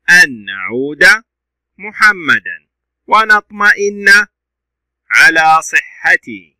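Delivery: slow, fully vowelled Arabic read-aloud; measured classroom story narration; model story reading. Shown as a demonstration.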